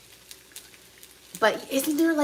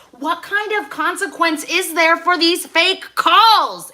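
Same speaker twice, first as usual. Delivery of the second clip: furious; 8 semitones higher, 7 dB louder, slower than usual. Real, not acted. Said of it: "I'm furious".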